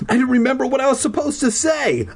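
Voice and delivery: rising high-pitched panic; hammed-up stage fright; mock panic. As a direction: climb in pitch as dread builds. Dream panic acted in narration.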